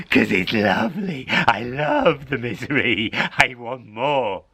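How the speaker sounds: odd voice, savouring the misery; greedy cat. Acted. Cat telling the old lady how it loves misery, greedy. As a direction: purr over the word misery.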